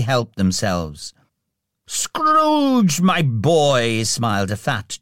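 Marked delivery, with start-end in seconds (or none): 1.9-4.0 booming-hearted jolly boss, much higher start; hearty, drawn-out call of greeting; fat, jovial voice. A narrator's reading. Jolly employer hails young Scrooge, jovially. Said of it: "jovial voice".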